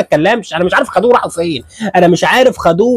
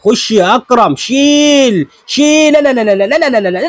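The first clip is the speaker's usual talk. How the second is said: excited urging; 6 semitones higher than usual. Real, not acted. Urging fighter, then shocked.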